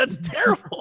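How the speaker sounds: high, teary mock whine; half-laughing cry of dismay; whiny protest. Real, not acted. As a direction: complain like it hurts to say. Outraged at the TV show.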